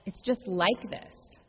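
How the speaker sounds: a reassuring mantra said slowly; slow, gentle refrain. Shown as a demonstration. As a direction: ease the judgment away, slowly. Refrain in the sermon.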